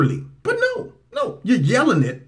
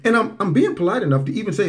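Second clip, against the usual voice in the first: normal talking voice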